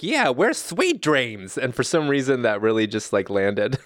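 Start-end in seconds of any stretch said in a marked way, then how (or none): 0.0-1.6 "Sweet" stretched out and pitched high; slow, high imitation of a co-host, leaning on "Sweet"; imitated emphasis on SWEET. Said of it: "you were like".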